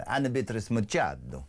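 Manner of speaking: a Brazilian Portuguese lilt on the name; Brazilian accent. Shown as a demonstration. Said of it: "That was very good".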